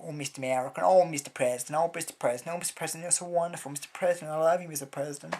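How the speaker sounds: loud, gushing, swooning mock adoration; mocking fawning Americans. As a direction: grovel theatrically and loudly. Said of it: "the way they go".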